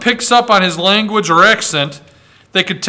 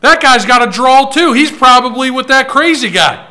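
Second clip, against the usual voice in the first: louder, higher mock accusation; accusing call-out; 5 semitones higher, 5 dB louder than usual. Bystander calling out a Southern stranger at the campfire, mock loud accusation. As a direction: point him out to the crowd. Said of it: "And you're like".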